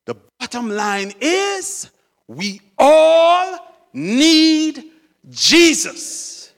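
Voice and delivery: slow, loud, high proclamation; emphatic preaching. Real, not acted. Preacher to congregation on the bottom line, proclaiming emphatically. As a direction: stretch each word and drive it home. Preacher drives home his refrain.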